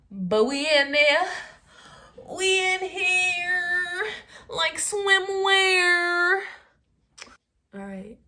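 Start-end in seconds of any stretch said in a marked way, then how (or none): 0.1-6.4 high, loud, drawn-out cheer; hyped-up singsong boast; playful triumphant catchphrase. Real, not acted. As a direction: stretch each phrase high and loud.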